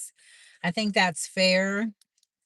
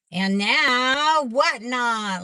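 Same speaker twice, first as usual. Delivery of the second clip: drawn-out excited; 6 semitones higher, 6 dB louder, much slower than usual. Real, not acted. Announcing new venture, drawn out.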